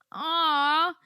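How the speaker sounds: a loud, high, melting aww; touched aww. Real, not acted. Touched by the chocolates, a loud high aww. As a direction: let out a big moved aww at the gift.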